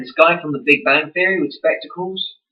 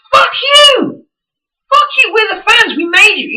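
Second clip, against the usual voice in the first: screaming, much higher and louder, near tears; furious shout; 6 dB louder than usual. Retold or imitated. Angry fan screaming at the snooty celebrities, furious. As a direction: yell it at them with everything.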